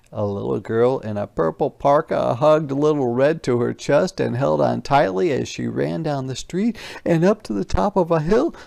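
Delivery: usual speaking voice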